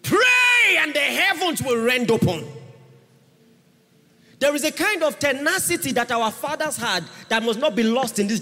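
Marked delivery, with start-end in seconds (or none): 0.0-2.4 high, loud declaration; preaching shout. Real, not acted. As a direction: proclaim it with full force.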